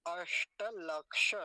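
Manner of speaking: अष्टलक्ष very slow, loud retroflex sh; retroflex sibilant demo. Shown as a demonstration. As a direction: press out both ष sounds in अष्टलक्ष. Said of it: "ऐसा".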